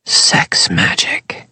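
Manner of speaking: slow breathy whisper; hushed reveal. Acted. Aaron revealing the secret to Jude, slow whisper.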